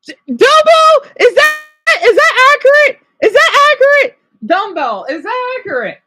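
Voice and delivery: high, loud excited call to a viewer. Real, not acted. Streamer excitedly asks a viewer about the accent, high squeal. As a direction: squeal the question at Dumbo.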